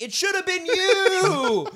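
high, slow taunting yell; mock jeering shout. Fans in the stands taunting a benched player, slow high yell. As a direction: jeer at him from the stands. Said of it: "and we were like".

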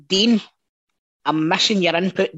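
ordinary talk

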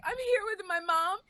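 imitated nervous young girl. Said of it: "giggling and laughing 'cause she was so nervous".

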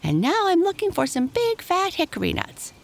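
very high, playful chipmunk voice; teasing chipmunk. A narrator's reading. Striped Chipmunk needling Happy Jack about nuts, teasing high voice. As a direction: pitch it way up and tease.

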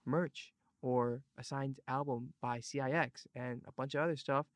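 ordinary talk, nothing marked

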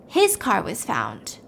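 ordinary delivery

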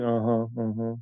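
just mumble along without lines; lightly imitated mumble from a lost actor; mumbled uh-huh. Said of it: "literally going".

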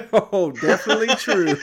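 laughing through the words; chuckling reply. Real, not acted. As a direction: answer while cracking up. Laughing at joke about Shane.

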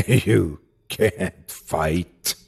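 slow, low croaking creature voice; croaking burble. Acted. The thing croaking at the narrator, slow and hideous. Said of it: "that hideous, croaking burble".

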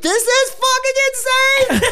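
very high, drawn-out yell; slow, high-pitched exclamation; thrilled retold outburst. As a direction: shriek it at your friend in delight. Retold thrilled outburst to his friend.

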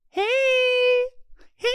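high, drawn-out hey; teasing phone greeting. Retold or imitated. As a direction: imitate a squeaky little phone hey. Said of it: "I would be on the phone, like".

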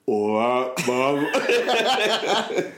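lightly put-on Cockney accent, Stallone style; a movie star's clumsy Cockney; a hint of a fake Cockney accent; Stallone doing Cockney accent. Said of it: "Sly Stallone putting on a Cockney accent".

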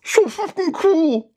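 high, slow squeezed mutter; drawn-out, high-pitched marvelling aside; awed mutter. Acted. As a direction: mutter in awe through a gag. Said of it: "you hear, um, Lyndon mutter".

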